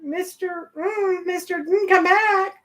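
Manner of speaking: high, pleading mock call of Mister; imitating a crew member calling him back; mock calling after him. Crew member calls the runaway back, in a high mock plea. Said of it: "he went running out the gate".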